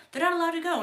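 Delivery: high-pitched imitation of a dismayed objection; mock protest. Acts Kyle's dismayed protest.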